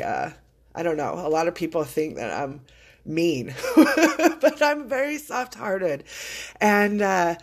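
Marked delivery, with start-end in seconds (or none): 3.6-4.4 quick high burst of laughter; light embarrassed laugh; short laugh. Real, not acted. Laughs at being called mean.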